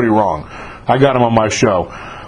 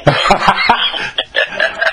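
breathy burst of laughter; a real, airy laugh; 6 semitones higher than usual. Real, not acted. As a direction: laugh out, delighted. Host laughs at story.

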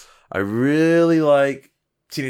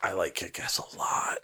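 soft unvoiced whisper; 4 semitones lower, 9 dB quieter than usual. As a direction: whisper it again, slow. Whisper bit repeated.

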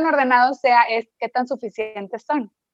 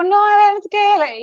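higher and louder flustered outburst; flustered protest; 9 semitones higher, 5 dB louder, slower than usual. Retold or imitated. Mother panicking at her soaked child, loud and high. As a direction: blurt it out in a panic. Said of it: "para mí era tipo".

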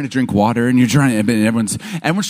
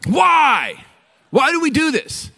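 emphatic challenge; 13 semitones higher, slower than usual. Real, not acted.

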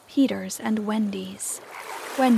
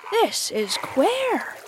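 high, slow bewildered gasp; 9 semitones higher, much slower than usual. A narrator's reading. Smee puzzled by the captain's order, gasping. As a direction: let it out high and drawn out, baffled. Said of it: "Smee gasped".

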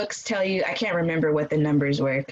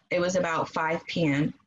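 ordinary talk

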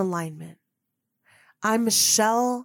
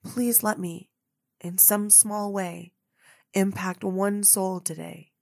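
softer, lower hushed prayer; a hushed heartfelt prayer to God; retold prayer; 5 semitones lower, 8 dB quieter than usual. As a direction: pray quietly, kneeling before dawn. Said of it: "And I said".

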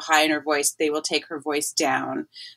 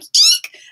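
a tiny shrill eek, pitched way up; high squeak; 8 dB louder than usual. Retold or imitated. Mom in labor panics, squeaking way up high. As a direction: squeal like panic taking over. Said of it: "my mom started to go way up in her voice".

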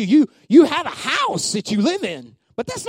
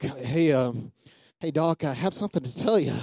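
bashful imitation; 8 semitones lower, 6 dB quieter than usual. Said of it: "I'm not gonna be like".

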